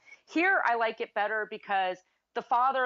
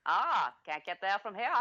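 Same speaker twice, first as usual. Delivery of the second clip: Maine accent. Shown as a demonstration. Co-host offers own stock accent phrase, in a Maine accent. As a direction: put on a thick Maine accent. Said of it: "Mi-, mine is".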